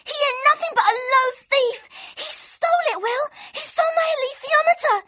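high, loud, tearful outburst; sobbing rage. Acted. Girl telling Will of the theft, sobbing loudly. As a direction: wail it out between sobs. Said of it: "she sobbed so passionately".

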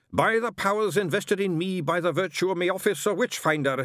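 high, ringing ritual chant; intoned. Acted. Witchfinder invokes his office against angel, intoning. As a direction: intone it like an exorcism formula. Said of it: "he intoned".

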